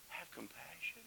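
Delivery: soft whispered compassion. Real, not acted. Preacher to congregation about Jesus, whispered. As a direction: breathe it out tenderly.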